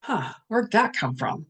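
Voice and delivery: wondering aloud, unhurried; a touch of playful curiosity; curious musing. Shown as a demonstration. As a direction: muse on it, curious and light. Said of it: "Get curious".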